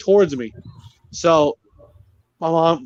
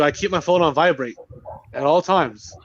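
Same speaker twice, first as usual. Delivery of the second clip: usual speaking voice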